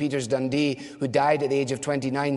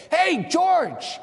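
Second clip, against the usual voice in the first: high, loud shouted warning across the shop floor; 11 semitones higher, 4 dB louder than usual. Retold or imitated. Worker on the shop floor shouting a warning to George, loud and high. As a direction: yell to stop him in time.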